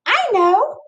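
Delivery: very high bright character voice; high Callie voice. Acted. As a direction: chirp it way up high. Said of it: "says Callie".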